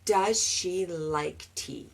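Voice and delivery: slow, soft model sentence; correct version. Shown as a demonstration. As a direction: model the right form slowly and softly.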